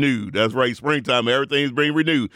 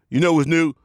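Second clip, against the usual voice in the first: usual speaking voice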